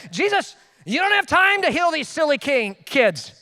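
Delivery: high, slow, exasperated objection; drawn-out, high-pitched mock indignation; angry Peter. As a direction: scold like an impatient disciple. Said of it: "Peter was angry".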